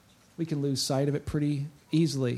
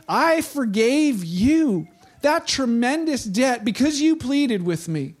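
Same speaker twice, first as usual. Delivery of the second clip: slow, higher-pitched acted royal rebuke; angry king; 5 semitones higher, slower than usual. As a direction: rebuke the servant slowly, voice raised in pitch. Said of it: "Then the angry king".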